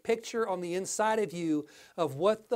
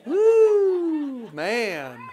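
delighted whoop; 2 semitones higher, 7 dB louder than usual. Real, not acted. Preacher whoops with joy after the song, laughing. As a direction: let out a joyful holler then laugh.

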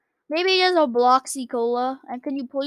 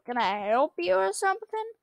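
usual speaking voice

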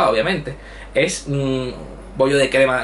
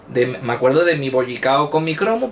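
normal talking voice

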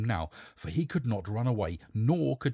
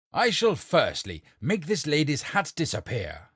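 proud, louder announcement; cried out; 5 semitones higher, 5 dB louder than usual. Acted. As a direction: proclaim it to the whole court. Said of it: "cried the king".

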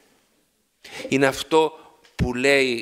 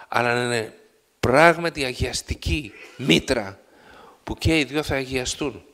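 normal talking voice

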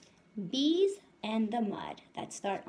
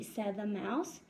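lively storybook read-aloud voice; reading to children; 3 semitones higher than usual. A narrator's reading. Teacher reading a picture book to her class, lively. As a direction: read it out brightly to the little ones.

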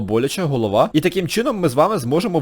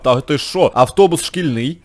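ordinary talk

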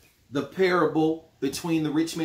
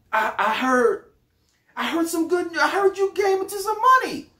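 overeager cousin; 10 semitones higher than usual. Acted. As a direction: gush like a long-lost cousin after money. Acted phone call, cousin caller.

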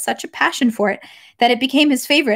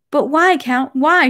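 higher-pitched, pleading questions; almost crying out; 4 semitones higher, 3 dB louder, slower than usual. A narrator's reading. Princess questioning the count's coldness, almost crying out. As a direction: let "why" burst out, just short of a cry. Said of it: "she suddenly almost cried out involuntarily".

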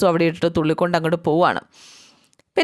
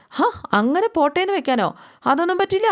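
indignant retort; 10 semitones higher than usual. Retold or imitated. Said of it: "എന്ന് അലോക് പറയുകയാണ്".

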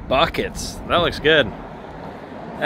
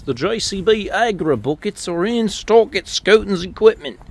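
light put-on British accent, higher pitch; 6 semitones higher than usual. Vlogger plugging JCB buckets in stock, mock British accent. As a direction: read the plug like a Brit, lightly. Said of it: "that is my British accent".

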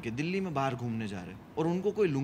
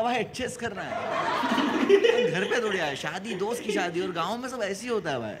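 pleading buddy; 6 semitones higher, 7 dB louder than usual. Retold or imitated. Friend defends the shabby wedding venue.

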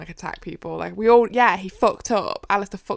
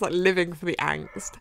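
usual speaking voice

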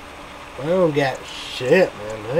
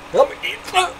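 much higher squeaky mock dare; squeaky taunt. Daring the fast food places, squeaky mock taunt.

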